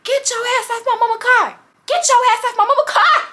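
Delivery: high-pitched mock outraged holler; imitated panicked yelling. Man yelling at girl on car.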